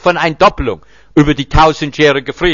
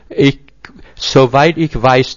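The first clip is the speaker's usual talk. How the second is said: usual speaking voice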